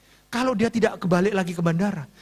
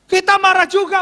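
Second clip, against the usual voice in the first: much higher, loud protest; indignant outburst; 12 semitones higher, 8 dB louder than usual. Real, not acted. Pastor admitting pastors get angry too, indignant outburst. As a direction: burst out indignantly, pitched high.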